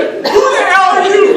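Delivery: very loud, high, drawn-out yell. Retold or imitated. Dad at the top of the steps challenging the principal, yelling. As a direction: bellow it down at a stranger. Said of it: "Yelling down at me".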